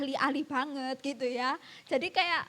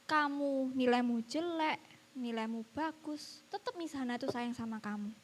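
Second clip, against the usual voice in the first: gentle reassurance; 2 semitones lower, 5 dB quieter than usual. Retold or imitated. Teacher reassures pupil she is still loved, gentle and soft. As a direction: reassure tenderly, letting the voice sink.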